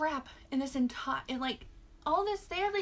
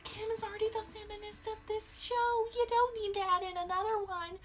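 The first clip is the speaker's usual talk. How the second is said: mocking quote; 9 semitones higher than usual. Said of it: "they were like".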